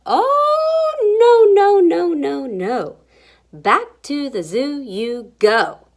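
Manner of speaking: loud, high, falling string of refusals; zookeeper's wife putting her foot down; scolding refusal. A narrator's reading. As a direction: start high and loud, step down firmly. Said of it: "and she says".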